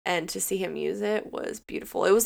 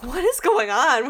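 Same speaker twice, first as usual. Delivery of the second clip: shocked disbelief; 7 semitones higher, 6 dB louder than usual. Retold or imitated. Reacting to the episode's whiplash.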